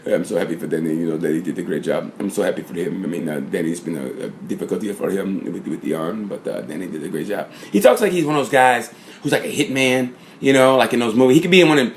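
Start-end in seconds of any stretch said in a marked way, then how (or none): 0.1-7.5 put-on much lower and softer, hushed accented manager voice; Livio Supo impression. Vlogger imitating the team manager praising Danny, low and hushed. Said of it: "I love how he talks".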